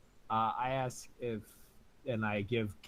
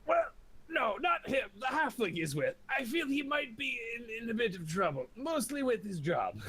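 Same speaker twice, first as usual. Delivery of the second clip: high character voice; 10 semitones higher than usual. Acted. Player character answers halfling.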